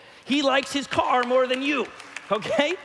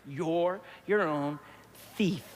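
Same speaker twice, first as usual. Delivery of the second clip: slow and pointed; 4 semitones lower, 4 dB quieter, slower than usual. Acted. Pastor repeats the point slowly.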